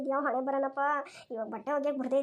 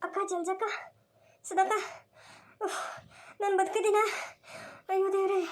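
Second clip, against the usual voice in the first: high, halting voice after near-drowning; just revived, higher voice with long pauses; dazed coming-round; 4 semitones higher than usual. Acted. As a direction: come to in short, disbelieving bursts. Rescued woman comes round.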